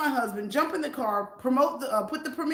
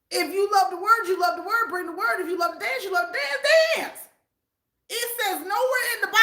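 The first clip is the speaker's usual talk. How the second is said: preaching; 7 semitones higher than usual. Real, not acted. Preaching to viewers about bringing the word. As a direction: build it like a sermon.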